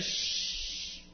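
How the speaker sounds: long unvoiced shush; crowd's hushing hiss. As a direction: hush someone with a drawn-out shh. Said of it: "The same ones that said".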